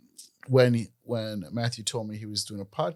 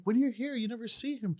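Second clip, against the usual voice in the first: wife voiced much higher; high mock wife; 12 semitones higher than usual. Husband quoting his wife about his friend, high mock voice. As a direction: play the wife, pitched up. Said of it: "She was like".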